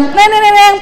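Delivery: high, quick nasal-consonant bell jingle; mimicked bell on a high held pitch; imitated school bell. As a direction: ring out "neneneneng" like a bell. Imitates bell at going-home time.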